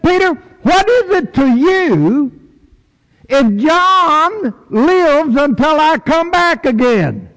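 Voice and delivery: high, slow, lightly voiced teacher; a hint of a solemn Lord asking; slow, high voice of Jesus, lightly done. Jesus voiced, stirring panic.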